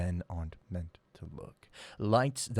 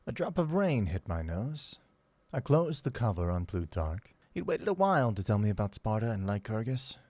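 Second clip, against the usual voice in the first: ordinary delivery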